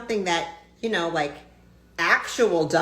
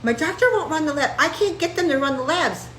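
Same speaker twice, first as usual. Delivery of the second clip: higher, fast whiny patient imitation; whiny mock complaint; 8 semitones higher, faster than usual. Mimicking followers who keep complaining about their doctor, whiny. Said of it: "If I hear one more time".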